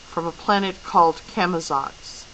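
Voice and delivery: ordinary delivery, nothing marked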